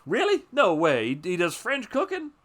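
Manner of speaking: high, loud, amazed "Really? No way"; incredulous disbelief. Retold or imitated. Chef marvels at a Vietnamese chef, loud and astonished. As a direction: squeal it like you can't believe it. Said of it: "where he's just like".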